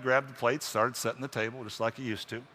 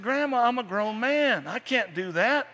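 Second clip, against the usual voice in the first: protesting grandson; 11 semitones higher, slower than usual. Acted. Jack protesting, slow and high.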